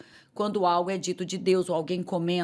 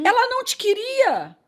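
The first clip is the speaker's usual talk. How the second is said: high-pitched imitation of envious churchgoers; resentful complaint; 14 semitones higher, 4 dB louder than usual. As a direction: mimic a wounded, indignant lament. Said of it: "começassem até a ficar ressentidas". (imitando queixa ressentida, em voz bem aguda)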